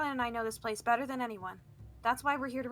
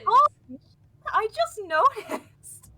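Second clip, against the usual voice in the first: high, slow through helpless laughter; laughing; 11 semitones higher, 11 dB louder, much slower than usual. Real, not acted. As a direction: choke on giggles. Laughing fit over stubble.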